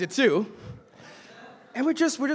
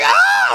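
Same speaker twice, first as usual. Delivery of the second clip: very high, loud pained yelp; retold scream; 9 dB louder than usual. Boy getting drops in his eye, high pained scream. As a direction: shriek as the drops hit your eye. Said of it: "I scream on the top of my lungs".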